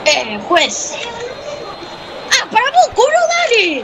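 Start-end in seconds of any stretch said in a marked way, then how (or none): 2.3-3.8 high-pitched indignant outcry; indignant protest. Real, not acted. Player complaining to the game referee, indignant. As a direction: protest the call at a raised pitch.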